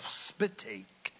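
normal talking voice

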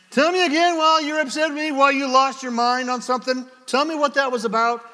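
high, loud, rushed demand; wrong, exasperated way. Shown as a demonstration. Husband demanding his upset wife explain, exasperated. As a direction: fire it out fast and high, fed up. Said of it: "Not like".

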